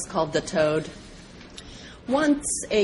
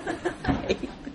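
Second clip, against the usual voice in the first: a high airy laugh at the punchline; breathy laugh; 13 semitones higher, 7 dB quieter than usual. Real, not acted. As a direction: laugh softly at the joke. Storyteller laughs at own joke.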